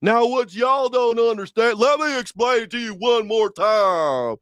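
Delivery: high-pitched mock Southern drawl; ex-father-in-law's Virginia drawl. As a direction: exaggerate a drawn-out Virginia twang. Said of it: "then he says something".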